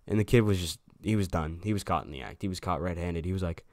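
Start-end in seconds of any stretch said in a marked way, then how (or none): none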